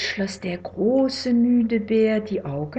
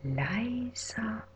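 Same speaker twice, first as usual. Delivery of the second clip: whisper, fading softer and softer; fading to silence; 14 dB quieter than usual. Shown as a demonstration. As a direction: let it fade to nothing. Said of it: "Leiser und leiser".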